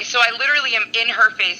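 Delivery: normal talking voice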